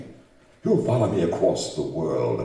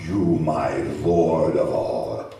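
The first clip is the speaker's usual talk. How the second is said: slow, loud sneering declaration; mocking reply; 8 dB louder, slower than usual. Monster mocking Frankenstein as his god, slow sneer. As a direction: turn his prayer back on him.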